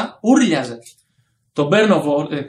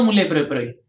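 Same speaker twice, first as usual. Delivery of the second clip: ordinary delivery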